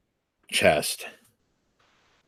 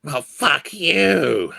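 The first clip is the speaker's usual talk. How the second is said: hissing reptile growl; 7 semitones higher, much slower than usual. Acted. Dragon answers the players.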